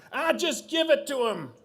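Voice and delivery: high, loud exasperated daughter. Acted. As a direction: plead loudly, fed up, as the daughter. Daughter snaps at father in story.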